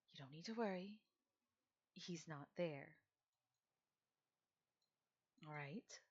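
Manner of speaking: calm her down, barely above a murmur; elder comforting a crying girl, tender and hushed; soft reassurance. Acted. Elder soothing panicked girl.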